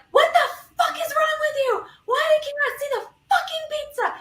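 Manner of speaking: high-pitched mock shout at the pizza kicker; retold hangry outburst. Retelling her tantrum at the stranger who kicked her pizza, high mock shout. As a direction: squeal it out like a tantrum over your pizza. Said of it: "throw a temper tantrum in front of the courthouse".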